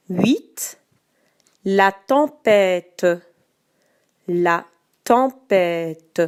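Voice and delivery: slow, carefully articulated dictation. Shown as a demonstration. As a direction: say each word clearly for spelling. Dictating each word twice.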